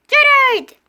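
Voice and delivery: loud call across. Acted. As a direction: shout the name cheerfully. Calling a friend.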